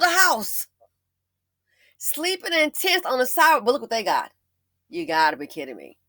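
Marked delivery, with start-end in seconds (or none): none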